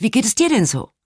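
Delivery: feigned cheer. A narrator's reading. Changing the subject with an upset friend, falsely cheerful. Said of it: "sage ich gespielt fröhlich".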